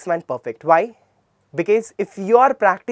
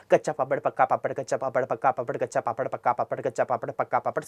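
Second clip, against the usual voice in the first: rapid-fire repeated tongue-twister in a lower, level pitch; tongue-twister drill; 4 semitones lower, 5 dB quieter, much faster than usual. Shown as a demonstration. Teacher showing students a tongue exercise, fast tongue-twister drill. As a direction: rattle it off fast and low, evenly. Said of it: "you should say some things like".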